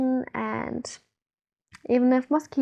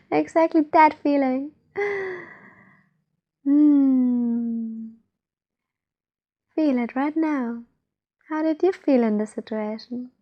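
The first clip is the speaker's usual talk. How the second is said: guided meditation. Acted. Guide leads listeners to feel trust inside, guided meditation. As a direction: ease the listener inward, unhurried.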